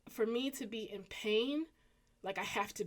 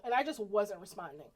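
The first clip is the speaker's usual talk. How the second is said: ordinary talk, nothing marked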